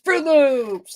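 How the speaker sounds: put-on high, loud, thrilled child's voice; imitating a child asking for Fruit Loops, bursting with excitement; excited kid. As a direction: squeal it like a kid who loves cereal. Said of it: "They get excited".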